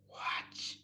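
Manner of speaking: soft hushed whisper. Real, not acted. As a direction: breathe the word secretly. Whispered cue to children.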